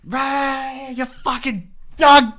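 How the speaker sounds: hammed-up crackhead yelling for smokes; begging crackhead. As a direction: plead for a smoke like a strung-out local. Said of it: "crackheads as well, but it's more like".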